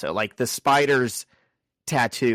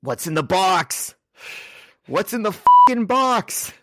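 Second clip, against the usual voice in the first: mock-frantic movie outburst, building to a high shout; hammed-up desperate demand; 8 semitones higher, 5 dB louder than usual. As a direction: escalate from raised voice to overacted yell.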